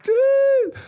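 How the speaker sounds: very high falsetto squeal back; mock squeal of recognition; imitated amazed greeting. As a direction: echo it in a tiny, drawn-out falsetto. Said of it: "And he's like".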